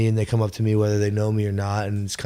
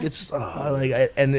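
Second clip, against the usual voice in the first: ordinary talk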